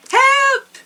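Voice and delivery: high, loud mock shout for help; cry for help. A narrator's reading. Puss cries out to the king for help, high and loud. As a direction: call out in alarm for help. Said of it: "cried Puss in Boots".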